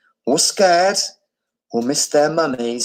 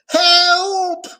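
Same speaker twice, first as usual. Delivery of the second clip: very high, loud desperate wail; wailing for help; 4 dB louder than usual. A narrator's reading. Abu's mother wailing as her baby drowns. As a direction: cry out in panic. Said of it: "wailed Abu's mother".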